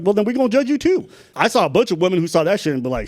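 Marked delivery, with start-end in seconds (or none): 0.0-1.1 high mocking comeback; mock retort. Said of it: "It's like".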